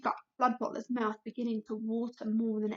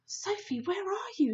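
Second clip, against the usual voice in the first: desperate; 7 semitones higher than usual. A narrator's reading. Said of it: "he thought desperately".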